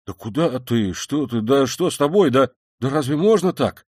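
flustered, rising protest; bewildered stammer. A narrator's reading. Razumikhin to his departing friend, utterly lost, stammering. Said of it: "бормотал совсем потерявшийся Разумихин".

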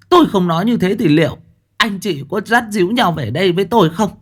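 mother rebuking her son for taking his wife's side; glaring mother. Acted. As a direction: glare and fire back at your son. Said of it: "Bà Tháo trừng mắt".

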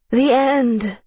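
high, slurred, drugged cry; a loud, drowsy, slurred wail. A narrator's reading. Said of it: "said loudly one more time in a slurred voice".

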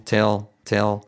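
"tell" with the L swallowed back; dark L. Shown as a demonstration. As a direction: TELL as 'te-ol'. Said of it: "es muy atrás".

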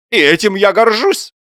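high, loud, proud proclamation; pompous theatrical boast; iambic declaiming. A narrator's reading. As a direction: chant it like a line of verse. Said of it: "подозрительным по ямбу тоном".

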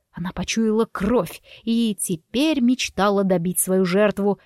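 usual speaking voice

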